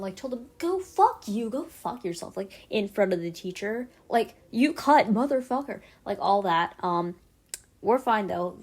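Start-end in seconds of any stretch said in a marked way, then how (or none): 0.6-2.4 acted angry outburst, high at the start; retold crash-out. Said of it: "I also crashed out". 4.5-5.8 loud, high-pitched acted insult; retold crash-out. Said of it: "I also crashed out".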